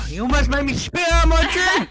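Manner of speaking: laughing, high and loud. Real, not acted. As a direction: burst out laughing mid-sentence. Laughing at the cherry joke.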